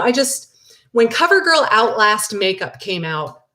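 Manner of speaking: usual speaking voice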